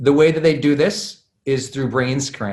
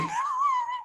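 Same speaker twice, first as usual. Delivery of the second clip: squeal of laughter; 10 dB quieter than usual. Real, not acted. Nervous about saying something touchy.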